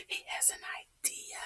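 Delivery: breathy stage whisper to listeners; whispered aside. Acted. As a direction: let them in on the secret, whispering.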